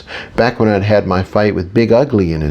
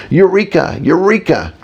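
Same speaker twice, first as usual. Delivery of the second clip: mock scream; 8 semitones higher than usual. A narrator's reading. Running through ancient streets celebrating, a high mock scream. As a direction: cry it out high, a restrained scream. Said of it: "screaming at the top of our lungs".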